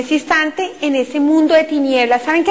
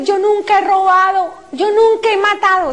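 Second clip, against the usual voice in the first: defensive protest; 7 semitones higher than usual. Retold or imitated. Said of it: "Y empecé a decir".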